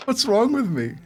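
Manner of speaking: ordinary talk, nothing marked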